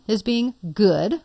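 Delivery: "good" set apart slowly, as if in quotation marks; air quotes on GOOD. Shown as a demonstration. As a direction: put audible quote marks round "good". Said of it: "quote unquote".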